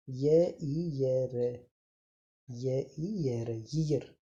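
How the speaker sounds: ईयर split into ई and रे, each repeated slowly, then joined; syllable demo. Shown as a demonstration. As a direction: spell out ईयर by its sounds for children. (ईयर को ध्वनियों में तोड़कर धीरे बोलना)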